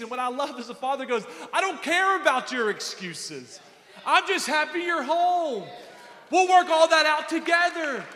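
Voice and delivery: loud, high preacher's cry; full-throated sermon climax; preaching at full force. Acted. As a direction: lift the room with it.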